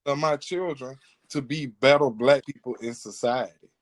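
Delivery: ordinary delivery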